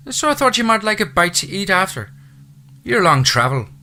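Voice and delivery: high, loud Irish housekeeper voice; chatty Irish brogue, pitched high. Acted. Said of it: "said in a thick Irish brogue".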